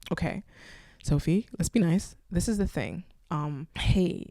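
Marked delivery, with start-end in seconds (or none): none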